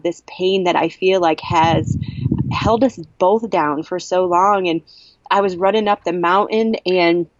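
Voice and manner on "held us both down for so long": ordinary talk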